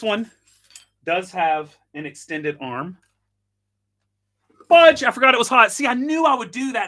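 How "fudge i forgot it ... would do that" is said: loud, high yelp at sudden heat; real startled outburst, pitched high; pained exclamation. Real, not acted. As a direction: blurt it out as if just burned. Burns hand on hot machine.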